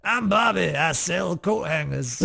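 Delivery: hammed-up character accent. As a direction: introduce yourself in a drawling joke voice. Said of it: "in our [name] voice".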